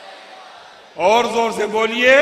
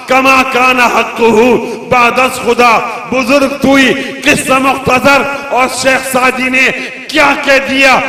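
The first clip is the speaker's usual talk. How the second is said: loud, ringing sermon recitation; preaching at full force; 5 semitones higher, 10 dB louder than usual. Acted. Preacher reciting Arabic praise verses to the gathering, full-voiced. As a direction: declaim the verses to the crowd.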